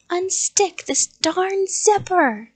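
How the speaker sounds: slow, high, loud yell; screamed. A narrator's reading. Man trapped in the costume to the child, screamed. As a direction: scream in exasperation. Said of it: "he screamed".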